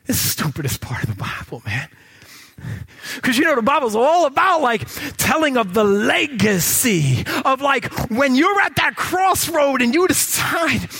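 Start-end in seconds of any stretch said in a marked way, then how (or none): 3.2-10.9 loud high preaching push; fired-up preaching. Acted. Preacher firing up the congregation about the crossroads. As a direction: preach it with rising fire.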